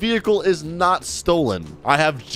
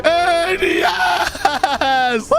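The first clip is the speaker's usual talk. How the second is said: real whoop after winning the race; victory shout; 12 semitones higher, much slower than usual. Real, not acted. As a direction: cheer like you just crossed the finish line.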